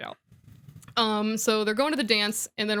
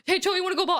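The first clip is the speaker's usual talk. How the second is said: nervous asking out; 9 semitones higher, much faster than usual. Retold or imitated. Acting Harry's nervous asking out.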